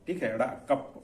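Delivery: ordinary delivery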